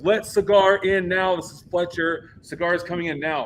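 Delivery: retold loud, insistent order; Fletcher. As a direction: imitate a commanding call over walkie-talkie. Fletcher orders over the radio.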